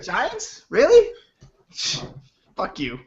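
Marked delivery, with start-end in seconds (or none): 0.0-0.6 high, disbelieving question; shocked disbelief at the answer; incredulous. Real, not acted. Reacts to rival team name. 0.7-1.1 high, loud incredulous question; can't believe what he hears; surprised disbelief. Real, not acted. Disbelief at rival team.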